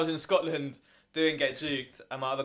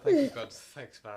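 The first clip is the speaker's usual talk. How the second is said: mock relief; 3 dB louder than usual. Passenger thanking God after a scary drive, laughing. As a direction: retell a grateful prayer, laughing. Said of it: "I was like".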